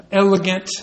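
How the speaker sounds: usual speaking voice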